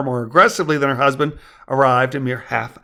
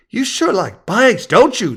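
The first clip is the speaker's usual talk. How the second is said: put-on high-pitched, slower kid voice; second child; 8 semitones higher, 5 dB louder, slower than usual. A narrator's reading. Boy asking new neighbor about bikes, kid voice. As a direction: ask it like a curious boy. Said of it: "the other boy said".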